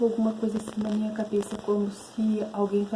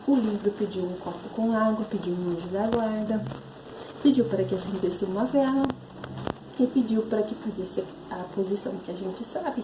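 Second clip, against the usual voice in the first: normal talking voice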